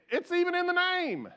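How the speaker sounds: says it through a high laugh; chuckling at the obvious; amused laugh on the words. Real, not acted. Amused at own point.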